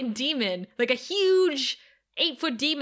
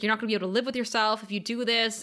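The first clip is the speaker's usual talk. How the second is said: plain reading voice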